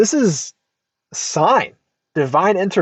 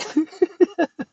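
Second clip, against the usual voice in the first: high laugh; 12 semitones higher than usual. Real, not acted. Amused at the question.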